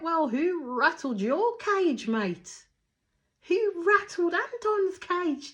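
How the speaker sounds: high, drawn-out mock-outraged yell; theatrical shouted taunt, words stretched out; taunting comeback. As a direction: holler a slow, high-pitched challenge. Acted loud taunting comeback.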